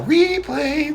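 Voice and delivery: very high, held-out call without shouting; restrained mock yell. Shown as a demonstration. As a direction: sing it out high, holding back the volume. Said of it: "I'm not gonna yell".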